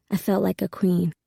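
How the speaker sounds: ordinary delivery, nothing marked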